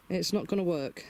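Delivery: ordinary talk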